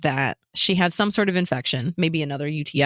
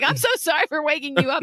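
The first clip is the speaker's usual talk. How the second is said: high, hurried apology; quick, apologetic mock fluster; retold apology; 8 semitones higher, faster than usual. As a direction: gush the apology in a raised voice. Retold apology to sleepy director.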